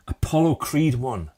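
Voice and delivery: usual speaking voice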